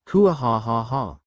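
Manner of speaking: slow, hammed-up evil cackle; a drawn-out gloating mock-villain laugh; dragon's smug triumphant laugh; villainous laugh.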